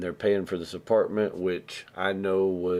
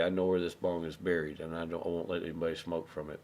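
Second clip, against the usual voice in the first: usual speaking voice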